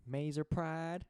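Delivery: imitated Southern lady, pitched high and loud; Southern lady's rallying cry. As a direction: call out the chant like a Southern headmaster lady. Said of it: "like, this Southern lady, and she would say".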